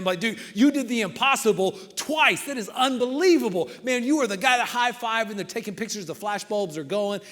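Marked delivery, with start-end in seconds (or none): 0.2-5.3 louder, higher excited cheering; celebrating fans. Acted. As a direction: gush like a thrilled fan. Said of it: "they're just celebrating him".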